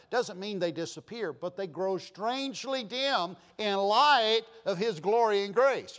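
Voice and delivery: fervent preaching. Real, not acted. Preacher urging the congregation to set their hearts above, fervently.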